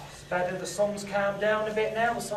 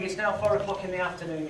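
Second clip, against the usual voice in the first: ordinary talk, nothing marked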